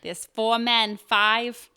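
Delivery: loud Boston try that lands in Jersey; Boston attempt drifting to Jersey; Jersey-sounding accent. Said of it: "That's more Jersey".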